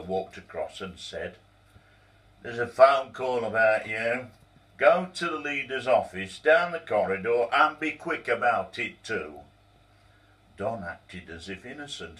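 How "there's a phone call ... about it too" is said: raised, urgent clerk voice; brisk order. Acted. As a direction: clerk ordering Donald to hurry, loud and brisk. Clerk barking orders at Donald.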